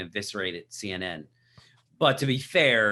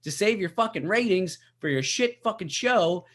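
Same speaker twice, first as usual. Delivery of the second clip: high, ranting comic-outrage voice pitched way up; Bill Burr impression; 11 semitones higher, 4 dB louder than usual. Podcaster imitating Bill Burr ranting at CNN, high and fired up. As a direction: rant like a worked-up stand-up comic. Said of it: "that wasn't the best Bill Burr".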